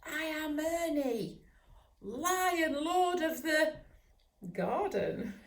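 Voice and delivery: high, proud little voice, lion lord pose; proud puppy. Acted. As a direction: puff up like a tiny king. Puppy proclaims himself lion lord.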